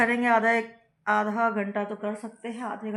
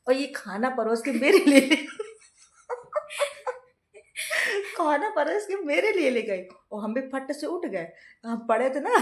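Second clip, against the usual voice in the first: laughing hard, louder and higher; can't stop laughing while telling it; real laughter; 7 semitones higher, 6 dB louder than usual. Real, not acted. Laughing retelling the mix-up.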